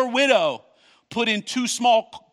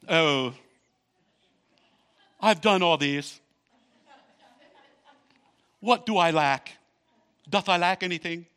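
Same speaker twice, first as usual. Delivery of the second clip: rich man voice; 2 semitones lower than usual. Shown as a demonstration. Preacher mimics the rich young man, lightly voiced and smug. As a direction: play the self-satisfied rich man asking what he lacks. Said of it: "like, that rich voice".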